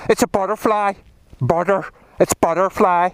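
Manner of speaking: lilting mock Northern Irish accent. Rider showing viewers what sounds romantic, Northern Irish accent. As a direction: put on a Northern Irish accent, not quite right. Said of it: "when you say it in Northern Irish".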